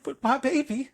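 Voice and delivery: high, wailing protest; mock-anguished parent over a child; distraught parent. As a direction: plead it high, hammed-up. Voicing parent of kidnapped child.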